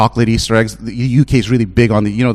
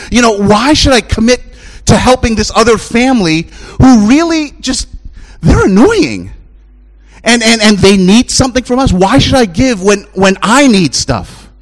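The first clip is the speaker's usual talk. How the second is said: high, loud pulpit preaching; rising preacher's call; full-force preaching; 11 semitones higher, 4 dB louder than usual. Acted. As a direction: preach it out to the whole church.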